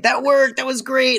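high, giddy triumph; gleeful parents. Retold or imitated. Parents celebrate their trick working, high and giddy. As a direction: crow over the win way up high. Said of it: "be like".

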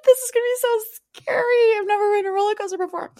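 very high, whiny hammed-up fright; mock scared. Imagined Kim going up the coaster hill with Pete, mock scared. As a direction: squeal it in pretend panic, speeding up at the end. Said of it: "you're scared".

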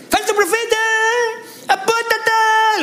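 very high, stretched heckling cry; mock shouting accusers. Enemies accusing the builder, high mock yell. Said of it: "los que gritan".

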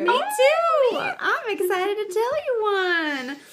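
high bubbly excited voice; excited squeal. Real, not acted. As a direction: squeal with delight.

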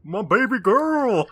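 high, drawn-out, hammed-up echo; imitating doting vampire. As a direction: gush it slowly in a put-on high voice. Co-host echoes the favourite line.

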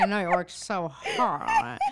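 hammed-up gumshoe, breaking into laughter; mock old-timey sympathy; old-timey detective. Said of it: "talking like an old-timey detective".

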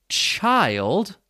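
put-on "child" with a long I vowel. Shown as a demonstration. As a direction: draw out the long I sound in "child". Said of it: "that is a long I vowel sound".

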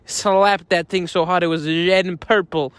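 ordinary talk

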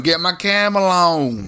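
slow, lazy stoner drawl; hippie stoner voice. Acted. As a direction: stretch it out like a burnout hippie. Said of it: "In my hippie stoner voice".

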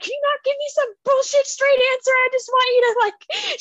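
very high, strained mock crying; retold tearful plea. Daughter begging her mom for answers, high imitated tearful voice. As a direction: imitate a daughter pleading through tears, pitch way up. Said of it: "crying and she's like".

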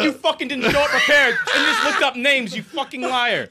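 yelling through laughter; laughing mock accusation; laughing shout. Retold laughing yell at friend.

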